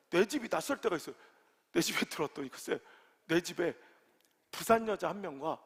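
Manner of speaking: ordinary talk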